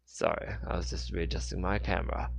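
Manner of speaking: ordinary delivery, nothing marked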